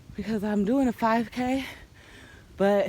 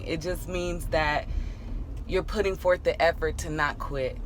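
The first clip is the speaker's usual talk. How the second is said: ordinary talk, nothing marked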